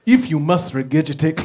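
put-on formal African-accented cab driver. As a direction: give the big word a comic African accent. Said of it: "if you say a big word in a funny accent".

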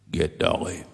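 lightly voiced frail man, low pitch; ailing old friend. Dying banjo player makes a request to his friend, lightly voiced low. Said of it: "Darrell started going downhill pretty quick".

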